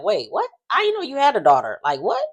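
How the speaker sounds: high, fast, stunned disbelief; mock shock. Bill caught off guard by Ted's daughter, hammed-up shock. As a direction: play it like the news just hit.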